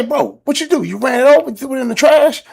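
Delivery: high, loud excited question; eager questioning. Retold or imitated. To cousin after he sped off, high excited questions. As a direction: fire the questions at him eagerly. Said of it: "I say".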